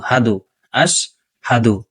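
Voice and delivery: হাদু, আশহাদু slow with a clear হ; correct pronunciation. Shown as a demonstration. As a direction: stress the হ in আশহাদু. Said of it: "সাধু বলা যাবে না". (হ স্পষ্ট করে আশহাদু)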